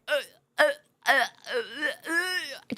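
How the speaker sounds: retelling how she choked on the spot, stammering in a choked voice; choking up. Retold or imitated. Reliving the embarrassing screenshot moment, choked stammer.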